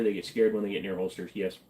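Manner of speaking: ordinary talk, nothing marked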